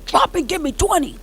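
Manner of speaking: military voice. Pretend. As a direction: bark it like a drill sergeant. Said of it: "you gotta say it in your military voice".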